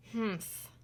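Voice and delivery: soft grumpy humph; sulky humph. Acted. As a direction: grumble under breath like a sulking child. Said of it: "said Becky".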